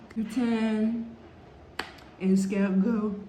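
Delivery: exaggerated French accent, a jumble of French words; lightly hammed-up French accent; mock French. Vlogger mocking how French people speak, in a hammed-up French accent. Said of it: "they speak like this".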